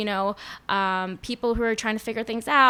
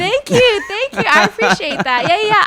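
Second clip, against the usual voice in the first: much higher, louder gushing thank you; delighted thanks; 11 semitones higher, 10 dB louder than usual. Real, not acted. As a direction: squeal out thanks for the compliment. Thanked for compliment on outfits.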